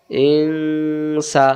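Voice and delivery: ইং held in a long hum before সা; hidden nun. Shown as a demonstration. Teacher reading nun sakin before sin, long nasal ইং. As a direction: stretch the nasal ng of ইং, then open to সা. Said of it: "গোপন করে পড়তে হয়".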